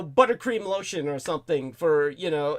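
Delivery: normal talking voice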